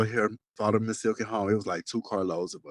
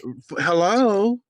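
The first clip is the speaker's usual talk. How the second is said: high, loud, slow incredulous hello; exasperated; 10 semitones higher, 5 dB louder, much slower than usual. Real, not acted. Reacting to friend's joke, loud drawn-out disbelief. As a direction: drag out the disbelief.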